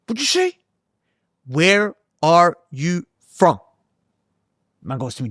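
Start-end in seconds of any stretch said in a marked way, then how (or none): 0.1-0.6 sharply raised, pushy what'd-you-say; imitating a hostile guy in a lift, much higher; mock confrontational American. Stranger asking again. 1.4-3.6 each word drawn out slowly and clearly; slowly restating the question to a confused stranger; slow deliberate. Retold or imitated. Repeats slowly after not understood.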